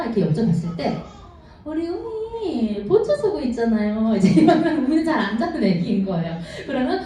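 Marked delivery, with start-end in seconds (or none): 1.6-4.1 higher, slow knowing nurse voice; a light nurse voice, higher and slow; teasing nurse. Retold or imitated. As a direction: drawl it out like a nurse hinting.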